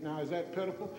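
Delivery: normal talking voice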